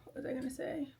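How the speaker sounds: whispered to herself; half-voiced murmur as she loses her thought; a breathy aside while she thinks. Real, not acted. Losing track, whispering to herself.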